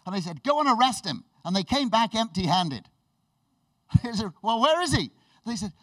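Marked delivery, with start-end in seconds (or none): 0.4-1.2 loud, high command; raised-voice order; acted order. Leaders' order to guards acted. 4.4-5.1 loud, high-pitched questioning; indignant raised-voice question; acted demand. Leaders demand the missing prisoner.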